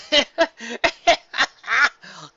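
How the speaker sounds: high giggles dying away; giggling. Acted. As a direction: laugh with relief. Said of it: "between dying giggles".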